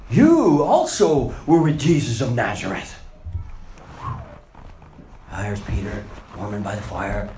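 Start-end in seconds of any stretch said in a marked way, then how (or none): 0.1-3.0 loud, pointed accusation; accusing servant girl. Acted. Servant girl accusing Peter by the fire, loud and pointed. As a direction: call him out in front of everyone. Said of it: "she looked at him and said".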